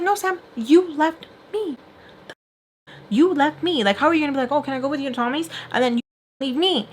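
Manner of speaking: high-pitched, wounded protest; indignant accusation. Pretend. Imagined accusation of the friend.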